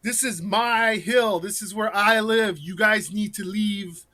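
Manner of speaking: Southern backwoods man ordering them off his hill; Southern accent. Retold or imitated. Vigilante to guests, Southern accent. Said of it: "I would talk with, like, a Southern accent".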